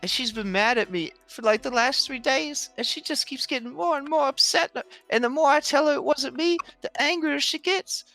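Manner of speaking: high, upset husband voice; a worried, whiny husband pleading innocence. Acted. GM voicing NPC partner.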